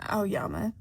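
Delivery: plain reading voice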